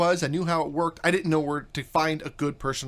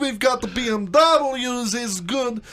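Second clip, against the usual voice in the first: high, hammed-up Russian accent; mock Russian accent; 6 semitones higher, 4 dB louder than usual. Host joining trainer's joke, mock Russian accent. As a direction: play a Russian radio pitchman with thick accent. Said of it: "pull out the Russian accent".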